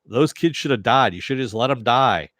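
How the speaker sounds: loud, scornful mock quote; lightly parodied callous advice, pushed out loud; Pa Kent. Fan mocking a film father's advice to let kids die, scornful. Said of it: "That was Snyder's Pa Kent advice".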